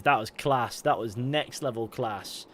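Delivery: usual speaking voice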